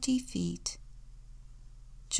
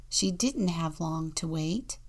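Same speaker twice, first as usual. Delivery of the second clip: ordinary delivery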